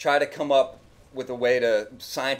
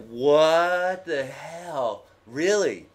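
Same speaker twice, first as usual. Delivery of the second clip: incredulous; 3 semitones higher, much slower than usual. Retold or imitated. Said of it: "I was just like".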